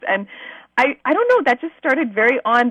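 ordinary delivery

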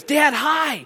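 put-on high-pitched little girl calling out; excited kid yell. Daughter greets dad off the bus, excited yell. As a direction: imitate a small daughter calling brightly to dad. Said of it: "Taylor yells out".

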